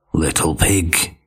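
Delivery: low, slow wolf call; big bad wolf calling out. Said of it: "he called out".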